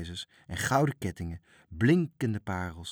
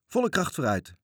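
higher, ringing order; captain's call; 7 semitones higher, 4 dB louder than usual. Acted. Little captain to his crew, ringing command. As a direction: call out to set sail. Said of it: "riep de kleine kapitein".

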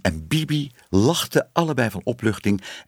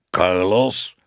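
drawn-out call of the name; mildly raised, commanding call; calling a dog; 2 semitones lower, 4 dB louder than usual. A narrator's reading. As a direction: call your dog back. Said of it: "riep een stem".

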